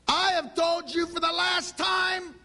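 shrill-free, all-out scolding shout; exasperated parent shout. Retold or imitated. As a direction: bellow it like a parent at the end of their rope. Parent yelling at ignoring kids.

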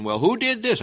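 high, loud demanding question; parent's demanding question. Retold or imitated. Parent questioning the children, high and loud. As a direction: demand to know who broke it. Parent finds something broken.

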